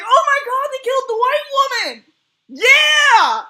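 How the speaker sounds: high, loud gleeful squeal; hammed-up thrilled scream; excited shriek. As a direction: shriek with shocked delight. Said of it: "I was like".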